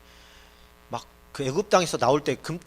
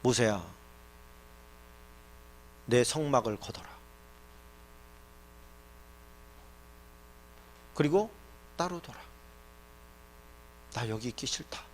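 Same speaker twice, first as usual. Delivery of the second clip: restrained God, dropping softer at the end; lightly voiced God; 3 semitones lower, 10 dB quieter than usual. God tells Moses He will not stay here, in a restrained voice. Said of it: "하나님께서 그렇게 얘기해요".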